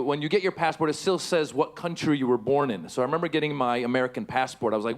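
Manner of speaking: usual speaking voice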